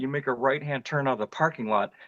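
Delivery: usual speaking voice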